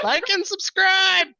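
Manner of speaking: high, slow, laughing sign-off. Real, not acted.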